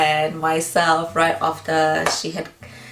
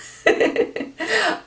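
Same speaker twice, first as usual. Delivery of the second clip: emotional laugh. Real, not acted.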